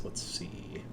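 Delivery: whispered to himself, barely audible; whispered aside. Real, not acted. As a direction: mutter it under your breath while checking something. GM muttering while checking sheet.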